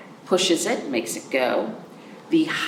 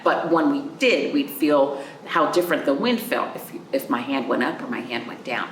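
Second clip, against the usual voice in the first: ordinary talk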